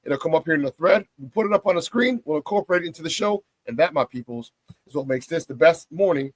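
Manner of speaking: ordinary talk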